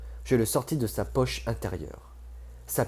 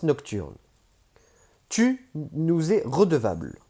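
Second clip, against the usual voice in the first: higher, louder cold voice of Alsafi; cold demanding Alsafi; 9 semitones higher, 5 dB louder than usual. Acted. Alsafi tells Page she owes them, cold and firm. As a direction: lay out the debt, unbending. Alsafi presses their claim.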